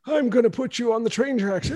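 high, mock villain voice; old cartoon villain. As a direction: ham up an old-time villain tying someone to the tracks. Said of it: "the old, like".

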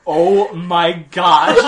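loud, drawn-out exclamation amid laughter; cracking up. Real, not acted. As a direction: gasp it out, overcome by laughing. Helpless laughter at the joke.

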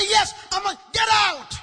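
high, full-volume furious re-enactment; angry shout. Retold or imitated. Preacher throwing the woman out, angry shout. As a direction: roar the order to leave. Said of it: "So I got angry".

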